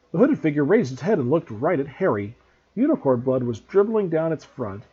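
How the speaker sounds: ordinary delivery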